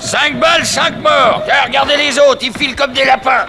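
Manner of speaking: high loud gloating; triumphant shout. Acted. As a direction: crow over the fleeing enemy. Triomphe après la fusillade. (cri triomphant)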